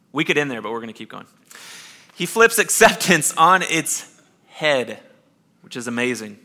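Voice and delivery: ordinary talk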